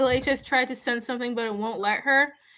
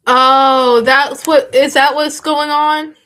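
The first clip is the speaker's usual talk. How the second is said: surprised realization; 10 dB louder, slower than usual. Real, not acted. Loud realization.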